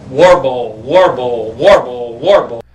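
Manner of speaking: warbling alarm imitation. Shown as a demonstration. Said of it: "a warble sound will go off like this".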